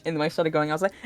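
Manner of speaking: usual speaking voice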